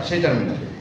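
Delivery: ordinary talk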